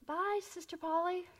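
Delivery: calling out. Retold or imitated. Men on the curb calling goodbye to Polly, called out. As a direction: call it across the street.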